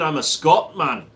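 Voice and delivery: Irish slipping into mock Scottish; Scottish accent. Shown as a demonstration. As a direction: let a put-on Scottish accent creep in. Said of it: "My Irish and my Scottish go too close".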